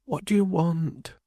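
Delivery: slow, high, soft weary question; wearily. Acted. Woman to her husband, slow and weary. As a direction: ask it tired of everything. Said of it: "asked she wearily".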